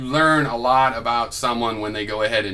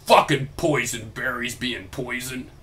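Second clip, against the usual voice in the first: mock complaint. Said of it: "and you go".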